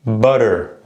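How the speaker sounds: American "Butter" with the T as a quick tap; American accent. Shown as a demonstration. Teacher models the tap, American accent. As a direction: say "Butter" American, tapped T and final R. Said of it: "put on your best American accent".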